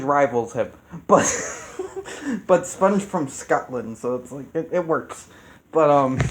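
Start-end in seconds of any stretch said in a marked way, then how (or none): 1.3-2.4 quick laugh that pops up high; high burst of laughter. Real, not acted. Laughing at his own remark about Sponge. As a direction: laugh at your own joke.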